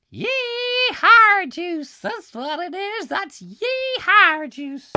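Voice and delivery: high loud slow cowboy whoop; hammed-up yee-haw. Naming the drink on his desk, loud high yee-haw.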